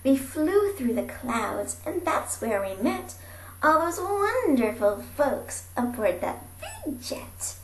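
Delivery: ordinary delivery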